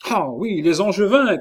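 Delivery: high, excited cry. A narrator's reading. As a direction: take up the war cry eagerly. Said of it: "cria Caylus". (cri aigu et exalté)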